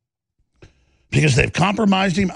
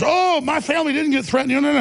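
mocking Joe; 12 semitones higher than usual. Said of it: "And he goes".

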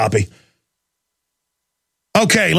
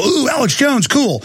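higher mock-impressed "ooh"; admiring passers-by; 7 semitones higher than usual. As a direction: imitate impressed fans, pitched up.

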